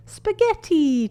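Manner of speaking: high, slow excited cheer. A narrator's reading. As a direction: stretch it out happily. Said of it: "cheered Peppa".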